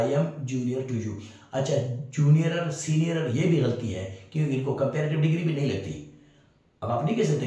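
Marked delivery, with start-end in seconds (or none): 0.0-1.1 slow, even reading of the right sentence; correct example, read slowly. Shown as a demonstration. Teacher giving students the correct English sentence, slow and even. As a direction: lay out "junior to you" calmly. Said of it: "इनके साथ".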